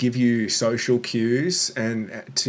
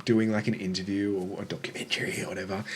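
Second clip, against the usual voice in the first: normal talking voice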